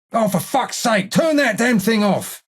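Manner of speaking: high-pitched irritated outburst; complain, fed up with the noise; exasperated. Acted. Snaps at someone over noisy thing.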